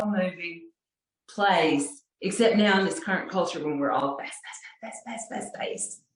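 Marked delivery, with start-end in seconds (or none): none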